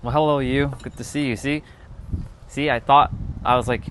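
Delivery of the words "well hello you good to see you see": ordinary talk, nothing marked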